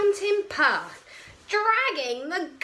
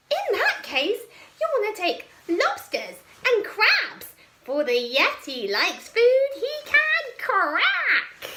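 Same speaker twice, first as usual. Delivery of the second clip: loud, high-pitched monster advice; 8 semitones higher, 6 dB louder than usual. Acted. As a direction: chirp the tip like a squeaky beast. Nettie's voice giving advice.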